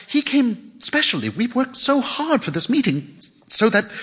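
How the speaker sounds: high-pitched shocked woman; shocked protest, voice lifted high. A narrator's reading. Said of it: "her voice shocked".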